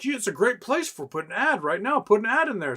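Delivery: mock YouTube voice. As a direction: play a greedy platform nudging you to run an ad. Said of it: "YouTube, like".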